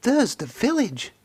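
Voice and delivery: high, tired but relieved engine voice; Thomas, puffing. A narrator's reading. Thomas spotting the village after the climb, high relieved voice. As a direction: spot the village with a puff of relief. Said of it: "Thomas puffed".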